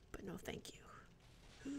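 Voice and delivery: slow whispered refusal; real dry whispered no thanks. Real, not acted. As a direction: whisper it slowly, drawing out the no. Sarcastic refusal in game.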